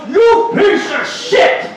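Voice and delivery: high, slow furious yell; angry shout. Retold or imitated. Homeless man cursing the speaker for no lighter, shouting. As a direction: bellow the insult slowly. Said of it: "He goes".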